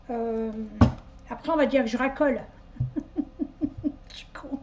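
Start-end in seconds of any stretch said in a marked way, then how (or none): 2.8-4.1 soft, breathy giggle in short bursts. Real, not acted. Joking she'll look like she's flirting, soft giggle. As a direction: giggle under your breath. Laughs at her own joke.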